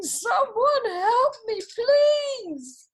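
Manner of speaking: high, loud, slow cry for help; shouting for help. A narrator's reading. Kiwi blown skyward cries out for help. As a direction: yell it from high above.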